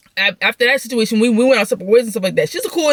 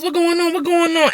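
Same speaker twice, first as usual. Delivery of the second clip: high-pitched mock urgent asking; urgent questioning; 8 semitones higher than usual. Cousins pull up asking what happened, high urgent questioning.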